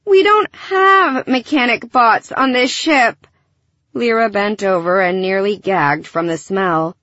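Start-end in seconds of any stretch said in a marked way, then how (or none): 0.1-3.3 higher, slow, emphatic exasperation; exasperated groan. A narrator's reading. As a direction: spell it out to a hopeless fool. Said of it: "Gilly groaned".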